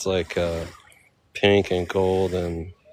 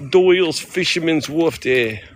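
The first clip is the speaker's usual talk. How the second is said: higher, hammed-up Boston-like accent; 7 semitones higher, 4 dB louder than usual. Tourist playfully announcing the wharf, higher Boston-like accent. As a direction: belt it out in mock Boston. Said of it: "No, that's Boston again".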